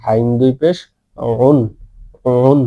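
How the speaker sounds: উন from the middle of the throat, slightly stretched; mid-throat, drawn out. Shown as a demonstration. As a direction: squeeze the Arabic Ain at the start of উন. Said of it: "হলকের মধ্যখান থেকে একটু টাইনে বলবেন".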